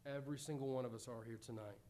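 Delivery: ordinary talk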